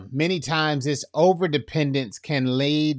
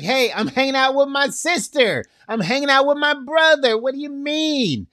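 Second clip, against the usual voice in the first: exasperated retort; 12 semitones higher, 4 dB louder than usual. Retold or imitated. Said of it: "A little emotional".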